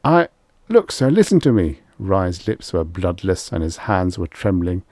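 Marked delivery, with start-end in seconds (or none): 0.0-1.8 high, loud, halting appeal; frightened plea. A narrator's reading. Rye begging Extroni to listen, frightened. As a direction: beg urgently with pitch well raised. Trembling hunter pleads with his boss.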